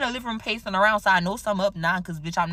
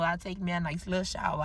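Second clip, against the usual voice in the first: ordinary talk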